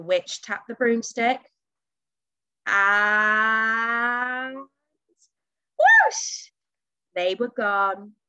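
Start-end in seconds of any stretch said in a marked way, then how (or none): 2.7-4.6 long, slowly rising build-up; suspenseful drawn-out word. A narrator's reading. Suspense before the broom takes off. 5.8-6.5 high, loud whoosh; whoosh sound effect. A narrator's reading. Imitates the broom taking off.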